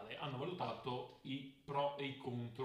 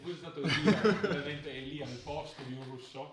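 laughing through words; 3 semitones higher, 8 dB louder than usual. Real, not acted. Laughing at the joke about Elia. (ridendo mentre parla)

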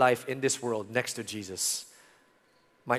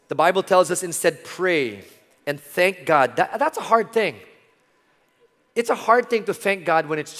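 louder, driving sermon voice; full-force preaching; 3 semitones higher, 5 dB louder than usual. Acted. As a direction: preach it out to the room.

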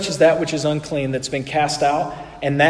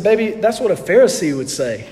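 laughing; 5 semitones higher, 8 dB louder than usual. Real, not acted. Teasing reply to his wife.

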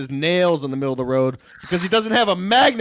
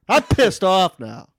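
ordinary talk

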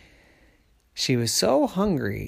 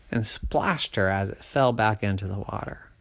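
usual speaking voice

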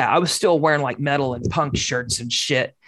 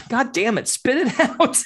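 higher, impatient mom voice breaking into a laugh; exasperated mom; 8 semitones higher than usual. Retold or imitated. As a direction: play mom fed up and snapping. Mother losing patience, voiced.

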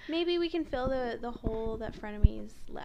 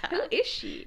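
laughing; 11 semitones higher, 12 dB louder than usual. Retold or imitated. Echoing friend's joke, laughing.

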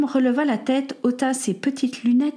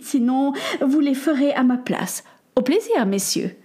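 higher, fluttery society lady giving orders; a haughty lady ordering her maid about, higher voice; fussy grand lady; 4 semitones higher than usual. Acted. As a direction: play a bossy, airy dame taking her leave.

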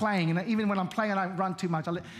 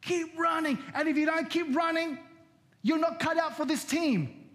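shouting coach; 7 semitones higher than usual. Retold or imitated. Tough soccer coach shouting at an exhausted player. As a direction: coach yelling at a player to keep running. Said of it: "I saw the coach say".

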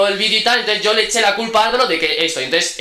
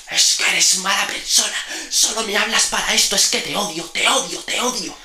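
mock hateful outburst; 4 semitones higher than usual. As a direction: imitate a melodramatic "I hate you" tantrum. Retold outburst of angry hatred.

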